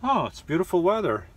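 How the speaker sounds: high, bright hammed-up delight at the cold; mock cheerful. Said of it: "I would be in here like".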